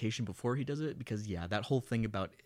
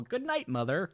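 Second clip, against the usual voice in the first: higher, chirpy sign-off; mock cheerful; 6 semitones higher than usual. Hamlet dragging the corpse out, mock cheerful farewell. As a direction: toss it off with put-on cheer. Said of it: "with a cheerful".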